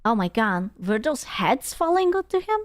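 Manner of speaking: ordinary talk, nothing marked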